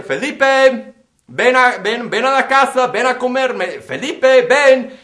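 loud, high call across the yard. Retold or imitated. Mother calling her son home to eat, loud and high. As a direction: call your boy in from far off.

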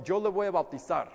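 ordinary talk

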